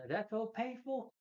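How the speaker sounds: high slow sneering line; mocking villain. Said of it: "He goes".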